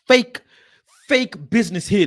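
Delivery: loud, indignant burst on each "fake"; angry accusation. Real, not acted. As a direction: punch out FAKE loudly. Accusing the staff of fakery.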